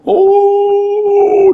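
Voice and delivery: loud, high, long wail; mock wailing. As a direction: imitate an old man bawling, hammed-up. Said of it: "dia meraung". (meraung olok-olok)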